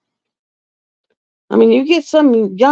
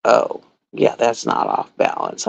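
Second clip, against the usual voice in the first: much lower, softer mumbling dad voice; mock dismissive dad; 12 semitones lower, 5 dB quieter than usual. Dad brushing off the nurse daughter, low mock mumble. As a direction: play down her worry like a stubborn old man. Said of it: "He's like".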